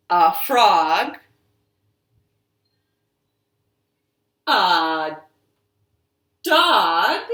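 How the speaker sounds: slow, sing-song picture-book reading with big high, loud jumps on key words; reading to children. Acted. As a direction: read it out to the children, drawing out each pause. Reads a picture book aloud.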